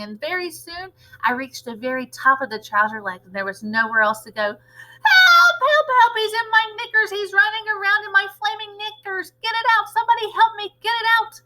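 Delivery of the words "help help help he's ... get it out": high, loud frantic cries for help; panicked cook. Acted. Cook with the mouse in his knickers, frantic high screams. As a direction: scream for someone to get it out.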